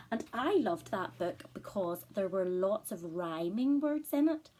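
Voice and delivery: ordinary delivery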